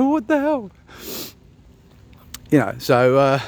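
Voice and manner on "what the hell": very high-pitched mock alarm; mock startled. Husband retelling being scared by wife, mock startled. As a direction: exclaim it way up high, playfully startled.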